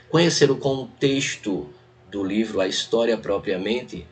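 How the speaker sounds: usual speaking voice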